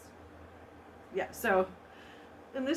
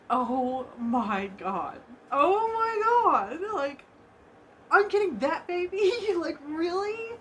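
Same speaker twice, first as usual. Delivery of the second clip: drawn-out amazement building to a high, loud pitch; retold amazement; 7 semitones higher, 5 dB louder, slower than usual. Winner recalling seeing the baby she was getting, amazed. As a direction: act out stunned, incredulous delight, slowly.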